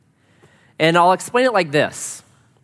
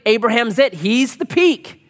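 hopeful exclaim; 6 semitones higher than usual. Retold or imitated. Hopeful congregation voice.

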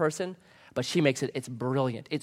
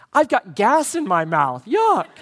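put-on high, laughing little-kid disgust; hint of a toddler's yuck; 5 semitones higher, 4 dB louder, slower than usual. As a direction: squeal it like a toddler tasting chalk. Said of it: "he goes".

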